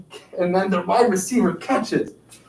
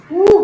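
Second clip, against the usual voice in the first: a high, loud whoop of celebration; bright celebratory whoop; high cheer; 12 semitones higher, 6 dB louder than usual. Real, not acted. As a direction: whoop for the finished set. Cheering after ten.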